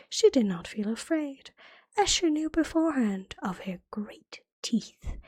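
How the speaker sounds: usual speaking voice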